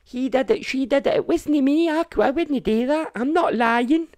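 put-on childish whine. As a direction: play a bickering child denying blame. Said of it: "It's like children".

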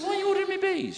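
high, laughing disbelief; incredulous. Retold or imitated. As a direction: squeak it in disbelief.

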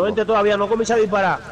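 urgent call. Real, not acted. Teammate urging not to rush.

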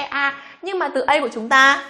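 ordinary talk

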